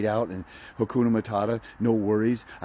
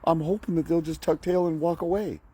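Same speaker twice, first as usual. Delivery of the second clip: meek excuse; 7 semitones higher than usual. Retold or imitated. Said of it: "And you're saying".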